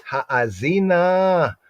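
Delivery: Ha'azina said slowly with a long first a, haa-zee-na; drawn-out Hebrew word. Shown as a demonstration. Teacher sounding out the Hebrew for students, slow and drawn out. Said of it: "broaden out the ear".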